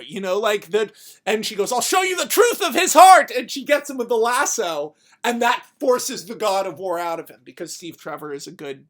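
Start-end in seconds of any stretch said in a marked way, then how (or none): 1.7-3.3 high, loud shouted vow; acted-out defiant shout; heroic battle cry. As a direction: belt it out like a hero mid-fight.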